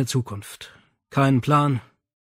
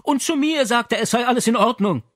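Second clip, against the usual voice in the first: higher, louder indignant mother; 10 semitones higher, 4 dB louder than usual. Acted. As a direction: huff it out, offended. Mother's outraged quote.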